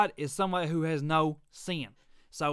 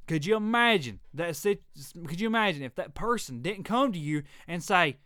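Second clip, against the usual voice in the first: ordinary delivery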